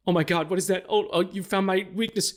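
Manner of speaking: high-pitched, hammed-up panic; mock fear. Spider-Man taunts the guy in the car, in mock fear. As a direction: pretend to be terrified, pitched well up.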